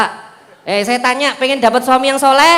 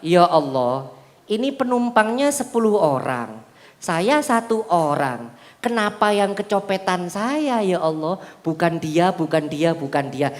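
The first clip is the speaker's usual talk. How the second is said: lower, softer, slow mild lament to God; humble self-reflection; 6 semitones lower, 5 dB quieter, slower than usual. Retold or imitated. Preacher voices a repentant victim praying softly after robbery. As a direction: ask God quietly, why me. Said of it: "Apa yang dilakukan?".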